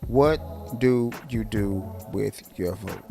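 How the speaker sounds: normal talking voice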